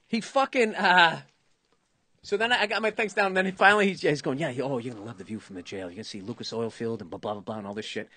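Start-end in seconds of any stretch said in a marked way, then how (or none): 4.4-8.1 lower, softer and fast taunt; imitating a smug seatmate's low gloating; mock taunting. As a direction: gloat over him under your breath. Passenger taunts about jail view.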